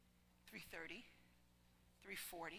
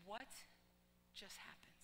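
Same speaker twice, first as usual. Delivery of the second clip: awed whisper; 3 semitones lower, 14 dB quieter than usual. Retold or imitated. Stunned woman in tears.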